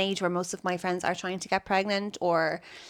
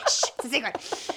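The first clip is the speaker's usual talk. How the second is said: higher, giggling shush; laughing mock hush; 5 semitones higher than usual. Real, not acted. Guest jokes her name is secret, laughing shush.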